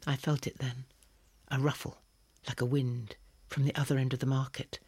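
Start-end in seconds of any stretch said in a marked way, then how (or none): none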